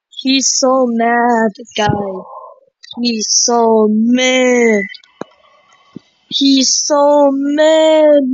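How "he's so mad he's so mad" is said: very slow, stretched vowels; slow lament, lingering on the last word; drawn-out dismay. Real, not acted. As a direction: drag each word out long. Reacting to angry game boss.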